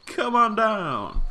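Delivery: loud, high, booming host voice; game show host. Pretend. Player hams up announcing park guests like a game show host. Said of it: "Sounds like I'm presenting a game show".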